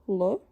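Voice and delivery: plain hard L, said short; a quick ordinary L; brief, unsoftened L; classical L. Shown as a demonstration. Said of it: "classical L".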